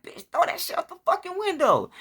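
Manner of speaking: very high, strained mock yell; high-pitched imitation of frantic yelling; retold panicked yelling. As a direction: squeal the order as if reliving it. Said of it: "I'm yelling".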